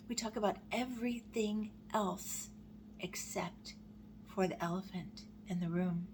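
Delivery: measured poem recital with long pauses; poem reading. Acted. Reading a grief poem to viewers, measured and solemn. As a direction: read it in short, weighted phrases.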